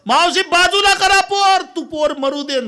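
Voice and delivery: high, loud yell from the driver; loud roadside warning shout. Retold or imitated. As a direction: shout at the woman to move the child. Driver shouting at woman on road.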